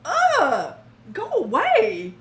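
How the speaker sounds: ugh, grossed-out brush-off; disgusted reaction. Retold or imitated. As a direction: push him away with disgust. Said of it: "It's like".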